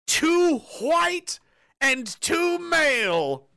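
milk each word of the headline in disbelief; mock headline reading. Reading the army headline to viewers, slow mocking disbelief.

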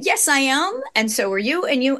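usual speaking voice

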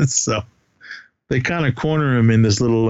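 ordinary talk, nothing marked

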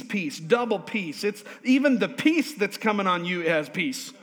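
loud, high full-force preaching; fiery preaching. Acted. Pastor driving the point home to church, loud fiery preaching. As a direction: build the congregation up with fire.

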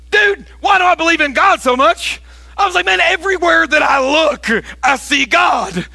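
a pastor gone fully overboard with joy, shouting to a coworker; excited preaching. Retold or imitated. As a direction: preach it at full blast, carried away. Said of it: "I was all excited".

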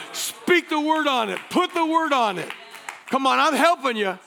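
rising pulpit exhortation; forceful, high-energy sermon voice; impassioned preaching. Real, not acted. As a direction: urge the congregation with driving emphasis.

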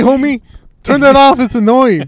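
high, loud mock complaint; complaining rat. Rat objecting to the vacuum noise, hammed-up annoyance. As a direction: yell like a peeved little homie.

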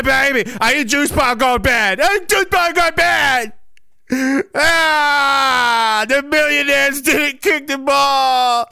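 high whiny baby wail, mocking; mocking crybaby voice. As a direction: squeal it like a bawling toddler. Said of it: "we cry for mommy now".

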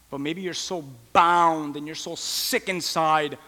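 ordinary delivery

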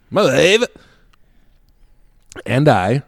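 high, rising mock exclamation; put-on catchphrase voice. Storyteller playfully echoing his own mention of his wife, mock exclamation. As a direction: crow it as a silly catchphrase.

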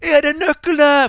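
loud, high, drawn-out yell; re-enacted shout. Audience member asks the stage about the keys, yelling. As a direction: holler it slowly as into a microphone. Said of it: "jeg roper da inn i mikrofonen".

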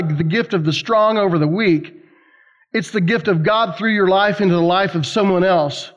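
ordinary delivery

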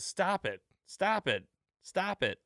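high, repeated plea, imitating a wife; Missus Hart. Wife repeating herself at her choking husband, high-pitched imitation. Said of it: "over and over and over".

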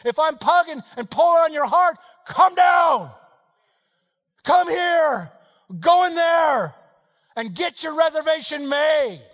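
loud, high, driving preacher delivery; full-force preaching. Acted. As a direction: preach it at a shout, urgent and relentless.

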